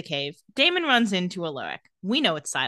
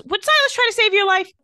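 high, louder pointed question; acted challenge; 12 semitones higher, 6 dB louder than usual. Mentor to gasping girl, insistent challenge. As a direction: push the question as an urgent challenge. [name] challenges struggling Bonnie.

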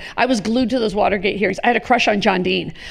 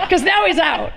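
said through real laughter, pitched up; laughing; 7 semitones higher than usual. Real, not acted. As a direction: laugh while admitting the mistake. Laughing at her own blunder.

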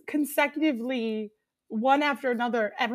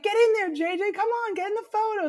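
teasing cheer; 9 semitones higher, faster than usual. Retold or imitated. Friends tease a young guy into a photo, high cheer. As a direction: hype him up for the photo. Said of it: "messing with him".

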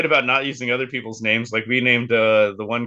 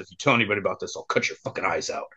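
menacing quiet threat; 6 semitones lower, 7 dB quieter, faster than usual. Retold or imitated. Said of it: "grabbed me by the shirt and said".

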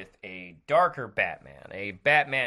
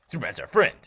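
ordinary talk

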